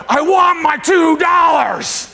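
high, slow, hammed-up shouted demand; movie character's demand. Guy who keeps going on throughout the movie, mock yell. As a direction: imitate a film character yelling for his money, words stretched. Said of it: "there's a guy who keeps going".

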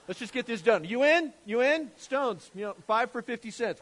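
high, loud comic recruiting of a mob; brisk, hammed-up rallying of accomplices; mock giving up. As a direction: hawk it like a jokey street vendor. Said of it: "It's like they just kinda give up".

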